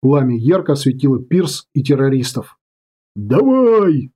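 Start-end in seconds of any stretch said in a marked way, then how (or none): 3.1-4.1 high excited yell. Acted. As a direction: let out an eager yell. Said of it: "не удержался от вопля Отто".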